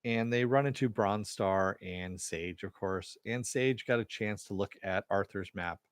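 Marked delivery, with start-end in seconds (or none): none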